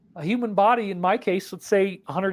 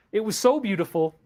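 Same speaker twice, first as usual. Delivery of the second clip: ordinary delivery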